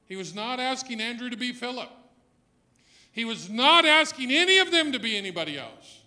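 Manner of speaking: loud, rising preacher's cry; full-force preaching. Acted. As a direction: preach it out to the back pew. Preacher builds again.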